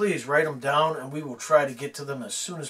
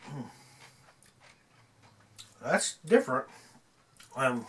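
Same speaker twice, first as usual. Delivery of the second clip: talking while eating; chewing pickle. Real, not acted. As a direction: mouth partly full. Seen 0: Bit pickle at -12, jaw chewing motions visible until about 4.9s.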